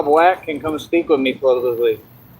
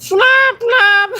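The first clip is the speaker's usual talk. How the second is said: high, loud squeaky shout; 4 dB louder than usual. Pretend. Showing off a silly voice, high squeal. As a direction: yelp it like a tiny bird. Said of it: "I start talking like that".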